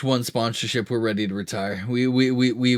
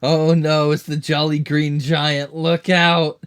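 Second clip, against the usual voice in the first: mock alarm; 5 semitones higher, 5 dB louder, slower than usual. Mock alarm at green giant.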